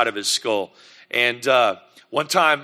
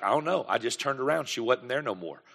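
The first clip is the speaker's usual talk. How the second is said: put-on softer, small child's reply; daughter's soft answer; 2 semitones lower, 9 dB quieter than usual. Daughter softly admits she lost her sister, quiet. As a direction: mumble it like a kid who doesn't know. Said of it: "Micaiah was like".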